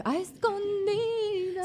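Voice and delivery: high, slow, hushed secret; slow dramatic drawl. Acted. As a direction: stretch it out like a confession. Dramatic echo of own words.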